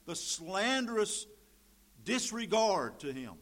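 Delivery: loud, ringing pulpit voice; loud scripture reading that rolls into preaching; full-force preaching. Acted. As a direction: preach it out to the whole room.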